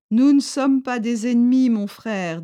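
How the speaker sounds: a firm rebel leader reassuring a stranger; chief's voice. A narrator's reading.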